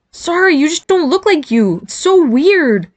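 high, drawn-out amazement; high-pitched brother marvelling at something strange; weirded-out apology. Acted. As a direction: blurt an apology, then stretch out the disbelief. Brother apologises, weirded out, wide-eyed.

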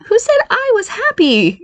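put-on very high, drawn-out girlish retort; slow, high-pitched comeback. A narrator's reading. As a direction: fire back in an indignant high voice. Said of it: "Barry retorted".

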